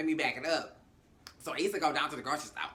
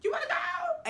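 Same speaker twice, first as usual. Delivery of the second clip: much higher, laughing, giddy; a high, laughing, breathless party girl voice; squealing excited girl; 9 semitones higher than usual. Retold or imitated. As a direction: squeal the invitation like a tipsy friend. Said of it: "one of the girls was like".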